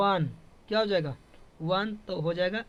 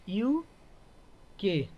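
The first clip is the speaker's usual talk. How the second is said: very slow, drawn-out UK with यू and के apart; UK broken into two long letters; much slower than usual. Shown as a demonstration. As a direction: spell out UK slowly for a class. Teacher sounds out an abbreviation.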